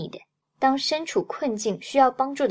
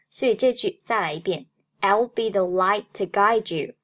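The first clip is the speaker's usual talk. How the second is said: ordinary delivery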